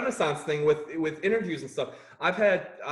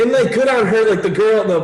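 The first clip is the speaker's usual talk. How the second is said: much louder, higher burst; loud, raised-pitch exclamation; emphatic outburst; 6 semitones higher, 13 dB louder than usual. Real, not acted. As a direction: blurt it out loudly over the laughter. Bursting in over the laughter.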